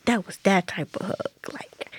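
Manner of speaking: soft whisper, moved. Real, not acted. Telling about hugging her mother after the ordeal, whispered. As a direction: let it fall to a whisper of relief. Describing the emotional hug.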